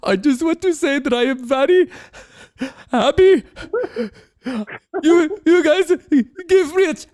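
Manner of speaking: hammed-up tearful speech, high and broken; imitated crying. Crying trainee thanking the room at graduation, hammed-up sobbing. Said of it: "this guy starts crying".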